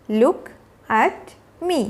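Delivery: slow, with a pause between words. Shown as a demonstration. Teacher modelling a call for a child's attention, word by word. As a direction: set "Look", "at" and "me" apart.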